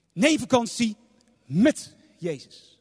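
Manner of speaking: slow emphatic met; sermon point, met drawn out slowly; stress on met. Shown as a demonstration. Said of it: "Dat is een heel groot verschil".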